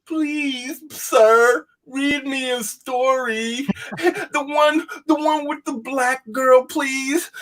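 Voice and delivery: put-on high, slow, pleading little-kid whine; mock whiny child. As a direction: beg like a helpless child, mocking. Mocking weak whiny kid.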